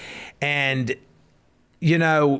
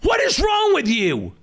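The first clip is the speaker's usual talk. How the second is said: hollering at mama; 8 semitones higher than usual. Retold or imitated. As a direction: shout in disbelief at her mother. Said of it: "I heard you upstairs hollering".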